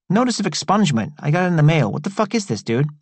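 high, fast, indignant street voice; riled-up Travis. A narrator's reading. Travis confronting Roberto about the letter, high and indignant. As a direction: demand an answer, baffled and rough. Narrator voices Tea Cake.

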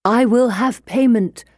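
narrated creature's insistent cry. A narrator's reading. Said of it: "the creature cried".

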